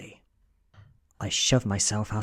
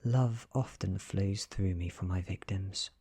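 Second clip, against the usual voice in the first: plain reading voice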